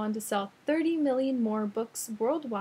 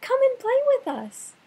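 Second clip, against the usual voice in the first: high, loud calling voice; 9 dB louder than usual. Acted. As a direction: call out across the water. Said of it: "they would call".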